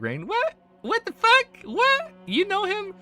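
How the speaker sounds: very high, squealed mock shock; shocked disbelief. Imitating her reaction on seeing Rain with him, shocked squeal. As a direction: shoot the pitch way up in incredulous questions.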